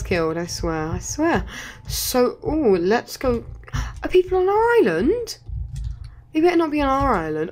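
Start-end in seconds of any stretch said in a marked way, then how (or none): 4.0-7.5 alarmed surprise. Real, not acted. Spots enemies on island.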